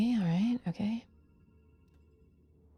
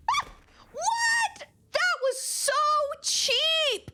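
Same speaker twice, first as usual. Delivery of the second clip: high shocked outburst; outraged yelp; much slower than usual. Real, not acted. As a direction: squeal at the cheap hit. Game outrage.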